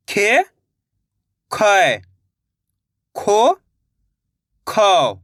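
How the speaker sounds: each syllable said alone, slow and clear, with a pause after it; syllable drill. Shown as a demonstration. Teacher drilling students on the barakhadi, clear syllable recitation. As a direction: recite syllables for the class to repeat. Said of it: "जिस तरीके से मैं एक एक अक्षर का उच्चारण कर रहा हूँ".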